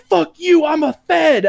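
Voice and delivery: high, loud indignant yell; mock defiant yell. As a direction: shout it like an outraged agent. Agent defiant in retold arrest.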